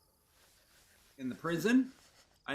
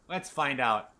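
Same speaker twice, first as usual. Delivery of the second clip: ordinary delivery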